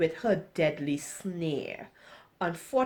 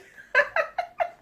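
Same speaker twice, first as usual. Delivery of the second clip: high-pitched burst of laughter; squealing laugh at oneself; 3 dB louder than usual. Real, not acted. Reader laughs at herself.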